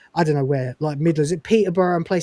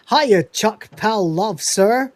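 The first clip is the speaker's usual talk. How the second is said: Northern greeting; 5 semitones higher, 3 dB louder, much slower than usual. Retold or imitated. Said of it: "All names that the Northerners use".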